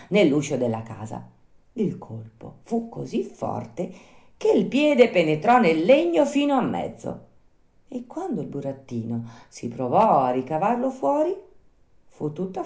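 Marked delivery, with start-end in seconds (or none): none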